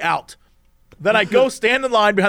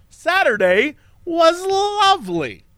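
higher, slow, dreamy; fond recollection; 8 semitones higher, much slower than usual. Real, not acted. Recalling the happy Saturday with friends, dreamy and slow. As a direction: savor the memory slowly. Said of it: "lovely day".

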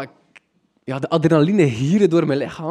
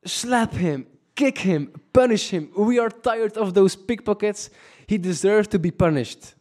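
higher, fired-up bystanders urging; egging-on locals; 7 semitones higher than usual. Retold or imitated. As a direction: whip up the crowd like an angry local. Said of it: "die reactie van de locals was zalig".

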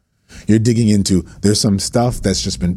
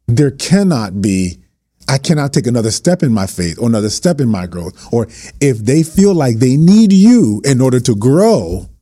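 impassioned preaching; 3 semitones higher than usual. Real, not acted. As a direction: preach it hard to pastors. Preaching at full force.

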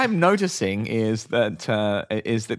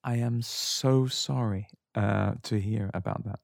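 slow, soft, lowered-voice apology; soothing apology; 4 semitones lower, 8 dB quieter than usual. Acted. Professional apologizer at Harrods calming a caller, soothing. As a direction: soothe with a drawn-out, hushed sorry. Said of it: "It's soothing, isn't it?".

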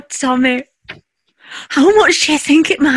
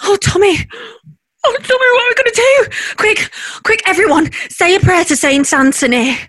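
panicking; 6 semitones higher than usual. Real, not acted. Wife panics over lost ticket.

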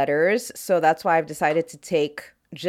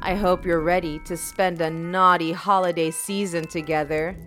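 sultry intro read. Acted. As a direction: purr it like a naughty invitation. Seductive podcast intro over music.

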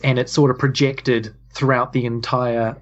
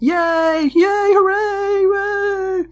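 crowd cheering; much slower than usual. Acted.

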